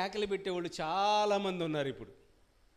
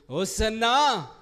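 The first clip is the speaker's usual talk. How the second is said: louder, drawn-out hosanna; loud cry of praise; 8 dB louder, much slower than usual. Acted. Healed believer crying praise to Jesus, loudly. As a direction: call out in praise, stretching the word. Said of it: "అందుకని గట్టిగా".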